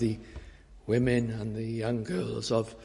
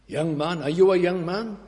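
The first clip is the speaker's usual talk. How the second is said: rising preaching; 6 semitones higher, 4 dB louder than usual. Acted. Preacher questioning the young men, rising fervour. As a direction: appeal climbing higher and louder.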